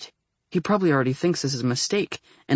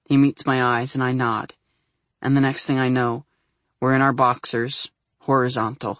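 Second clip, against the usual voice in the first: usual speaking voice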